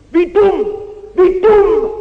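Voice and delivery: loud, high scornful cry; exclaiming in loud scorn; scornful outcry. Real, not acted. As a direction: hammer home how foolish it is. Preacher repeats in rising scorn.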